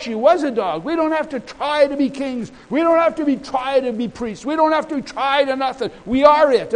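high-pitched, insistent sermon delivery; emphatic preaching. Real, not acted. Preacher telling the congregation they needn't try, emphatic preaching. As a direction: drive the point home forcefully. Preaches the point at full force.

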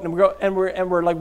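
normal talking voice

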